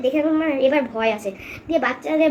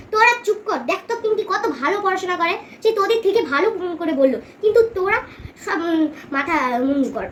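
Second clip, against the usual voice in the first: teacher yelling at her pupils to shut up; furious teacher telling off the children; angry scolding; 3 semitones higher than usual. Acted. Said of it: "এই বলে ম্যামটা রেগে মেগে".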